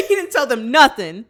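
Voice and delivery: loud, laughing exclamation; amused laughing. Real, not acted. Recapper mocking the realtor who hid the ghost, laughing loudly. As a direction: burst out laughing as you say it.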